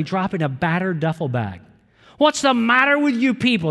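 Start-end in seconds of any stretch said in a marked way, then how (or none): 2.2-3.7 put-on high, loud angry outburst at the old couple; furious yell acted out in the story; exploded outburst. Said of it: "That's how he exploded".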